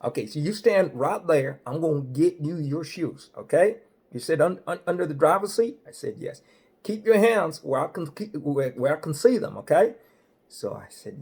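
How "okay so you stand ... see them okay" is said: hammed-up highway patrolman; state trooper voice. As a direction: play the big-voiced cop laying down the law. Trooper gives commands.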